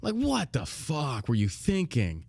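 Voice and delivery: very slow, drawn-out disbelief. Retold or imitated. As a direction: stretch each word out in stunned disbelief. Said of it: "I'm like".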